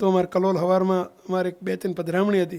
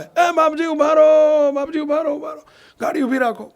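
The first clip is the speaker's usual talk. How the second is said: calling out; 6 semitones higher, 4 dB louder than usual. Retold or imitated. Villager running up shouting for Bapji to stop the jeep, calling out. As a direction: call out urgently to stop a car. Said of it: "તે સામેથી બૂમો પાડતા આવે".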